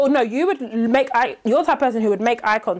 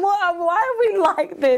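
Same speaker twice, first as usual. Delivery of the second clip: high amused musing; 7 semitones higher, slower than usual. Retold or imitated. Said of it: "I was just thinking".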